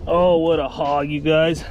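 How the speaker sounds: ordinary talk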